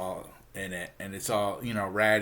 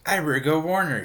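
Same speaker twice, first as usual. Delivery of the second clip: loud, higher mock urgency; urgent resolve; 7 semitones higher, 7 dB louder than usual. Archie decides to warn Betty, urgently. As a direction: act out springing into action.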